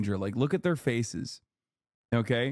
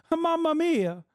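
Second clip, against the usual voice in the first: very high, hammed-up Italian woman voice; mock Italian accent; 12 semitones higher than usual. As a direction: squeal it like a cartoon Italian, Mamma mia. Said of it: "Now I'm saying".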